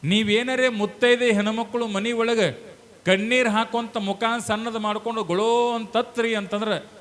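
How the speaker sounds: high-pitched sermon warning. Real, not acted. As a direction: lift the voice to warn the congregation.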